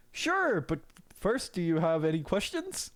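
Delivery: high, friendly gatekeeper voice; Saint Peter. Acted. Saint Peter welcoming Waffle to heaven, high and friendly.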